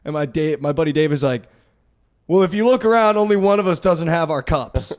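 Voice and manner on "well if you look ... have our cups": smug retort. Retold or imitated. Buddy Dave's sarcastic retort.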